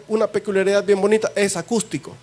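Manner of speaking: ordinary talk, nothing marked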